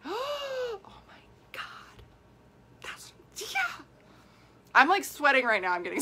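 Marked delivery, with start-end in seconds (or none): 0.0-3.8 breathless whispered slow horror; gasp, then whisper it in disbelief; shocked whisper. Pretend. Reader reacting after a gasp.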